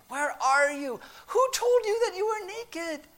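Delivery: high, pained pleading; acted anguish, voice soaring on the questions. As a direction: plead like a heartbroken loving parent. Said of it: "Can you hear the pain in God's voice?".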